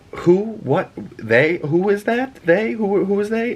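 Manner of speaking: high-pitched baffled questions, imitated; mock bewildered rising queries; stunned. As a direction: act out stunned confusion, voice rising on each question. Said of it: "she's kinda stunned for a minute".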